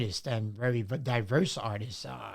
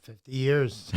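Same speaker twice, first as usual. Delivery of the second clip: ordinary talk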